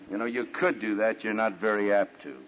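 ordinary talk

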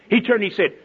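ordinary talk

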